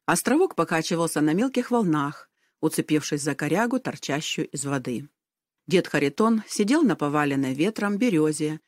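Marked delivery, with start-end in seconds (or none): none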